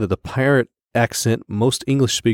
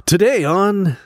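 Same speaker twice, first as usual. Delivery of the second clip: bright, higher anchor opening; news anchor voice; 9 semitones higher, 4 dB louder, slower than usual. Shown as a demonstration. Asked for a reporter accent, news anchor voice. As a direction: launch into a newscast. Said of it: "Can you do one of those news reporter accents".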